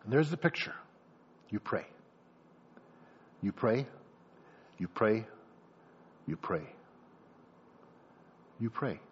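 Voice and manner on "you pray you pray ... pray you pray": low and soft, with long pauses; subdued, low-pitched reflection; hushed, prayerful. Acted. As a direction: repeat it quietly, like steady prayer. Pictures mother praying while waiting.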